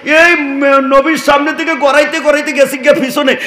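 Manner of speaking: high, driving sermon chant; impassioned preaching. Real, not acted.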